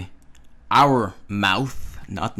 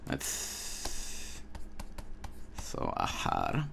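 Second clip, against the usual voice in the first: quiet; 13 semitones lower, 11 dB quieter than usual. Real, not acted. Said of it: "drops voice suddenly".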